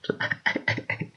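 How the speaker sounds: soft unvoiced chuckle; a breathy little laugh; quiet breathy laugh. Real, not acted. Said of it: "I don't know why I am laughing".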